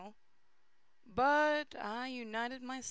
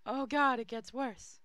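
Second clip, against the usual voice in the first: exasperated; 4 semitones higher, 5 dB louder than usual. Real, not acted. Exasperated at archaic text.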